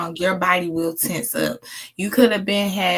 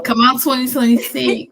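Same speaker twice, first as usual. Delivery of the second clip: eager cheer; 4 semitones higher, 7 dB louder than usual. Retold or imitated. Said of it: "I might say".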